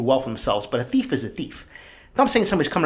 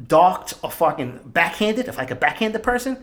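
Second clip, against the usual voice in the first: usual speaking voice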